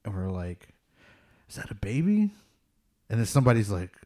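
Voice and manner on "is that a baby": high, rising incredulous question; imitating baffled friends; retold puzzled question. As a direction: ask it with pitch climbing in disbelief. Retold puzzled group reaction.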